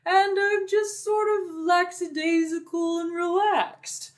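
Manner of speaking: slow, relaxed Minotaur voice; easygoing monster blacksmith, in no hurry; lackadaisical Minotaur blacksmith. Shown as a demonstration. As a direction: talk lazily, drawing the words out like a laid-back Minotaur. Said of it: "I just lower my voice and I talk kind of slowly".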